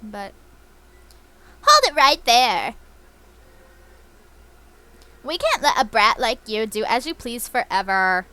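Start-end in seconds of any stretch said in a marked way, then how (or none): 1.6-8.2 commanding Team Rocket villain. Acted. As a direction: boom it out like a villain boss. Reading villain Ariana's dialogue aloud.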